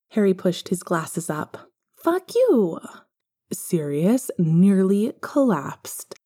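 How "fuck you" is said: put-on high, blunt child cursing; young boy proudly repeating a swear; little boy voice. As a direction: pitch way up and spit it out like a small boy. Young Harry retells his swear.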